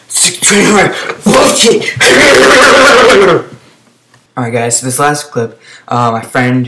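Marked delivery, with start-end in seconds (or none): 0.1-1.9 high-pitched, strained exclamation; worked-up outburst. Real, not acted. Gamer losing it over his opponent's huge hit, high-pitched outburst. 2.0-3.5 long, loud, high-pitched laughing shriek; loud high outburst. Real, not acted. Gamer losing it over the clip, long high shriek. Outburst after the big hit.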